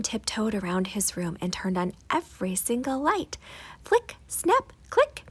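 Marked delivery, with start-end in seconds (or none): 3.8-5.2 high, bright flick snap click; switch clicks. A narrator's reading. Narrator mimicking the light switches, high and sharp. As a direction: pop each switch sound high and sharp.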